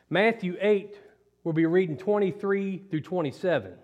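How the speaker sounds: ordinary delivery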